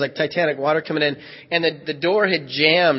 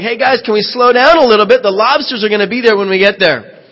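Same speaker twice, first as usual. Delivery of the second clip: louder, higher call over the noise; yelling up; 6 semitones higher, 7 dB louder than usual. Retold or imitated. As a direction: shout up to the deck. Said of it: "I yell up to the guys".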